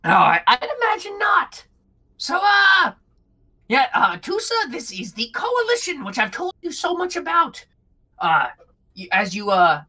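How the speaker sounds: high, chirpy little kobold with a backhanded compliment; squeaky kobold Bug. Acted. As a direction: jump the pitch way up, cheeky and friendly. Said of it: "And he goes".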